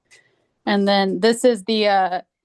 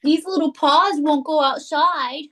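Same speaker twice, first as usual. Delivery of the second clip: pet talk; 6 semitones higher than usual. Real, not acted. Talking about her cats.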